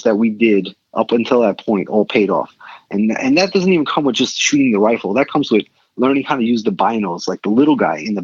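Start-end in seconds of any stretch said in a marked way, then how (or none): none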